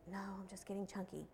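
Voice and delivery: stage whisper. Retold or imitated. Flustered mom answers her little girl, mock stage whisper. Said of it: "stage whispering".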